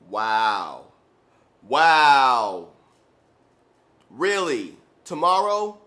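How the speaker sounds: loud, stunned wow; mock outraged disbelief; incredulous. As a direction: hit the disbelief hard. Disbelief at another reschedule.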